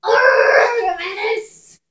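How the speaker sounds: loud, high pirate bellow; pirate shout. Acted. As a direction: roar it like a pirate captain. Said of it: "you're supposed to be saying "arg".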